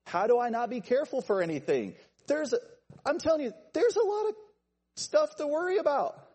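very high, loud, worked-up voice; anxious protest. Acted. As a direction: plead frantically as an overwhelmed worrier. Voicing an anxious person's protest.

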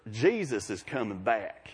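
put-on a Southern Mississippi accent, drawn out; deep South accent. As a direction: stretch it out like a Mississippi coworker. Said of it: "she's got deep South".

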